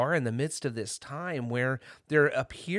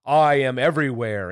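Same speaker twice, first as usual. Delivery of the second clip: loud, solemn proclamation; mighty wizard's loud declaration; 10 dB louder than usual. A narrator's reading. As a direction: announce it grandly, as an unseen power. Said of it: "they heard a solemn voice".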